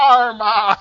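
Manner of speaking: loud mock call. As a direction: shout the name out. Calling out to the guest.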